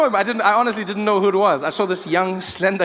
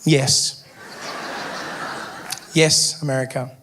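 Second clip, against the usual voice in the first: deadpan; 6 semitones lower, slower than usual. Retold or imitated. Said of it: "I'm like".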